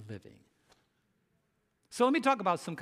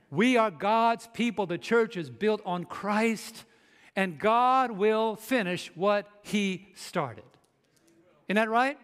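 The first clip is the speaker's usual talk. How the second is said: higher, forceful sermon delivery; raised, declaratory pulpit voice; emphatic preaching; 4 semitones higher, 5 dB louder, slower than usual. Real, not acted. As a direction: drive each point home to the congregation.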